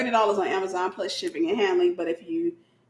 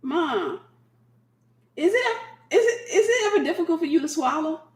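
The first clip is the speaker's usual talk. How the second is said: high, laughing through it; sister's cheeky question, breaking up with laughter; innocent-sounding question; 9 semitones higher than usual. Retold or imitated. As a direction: try to keep a straight face and fail. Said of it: "She was just like".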